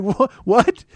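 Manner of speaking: high, loud stammered what; imitated startled double take; mock shock. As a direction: stammer it like a stunned clerk. Clerk hears brother is dead.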